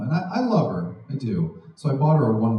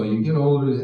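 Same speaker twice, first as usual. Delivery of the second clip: ordinary delivery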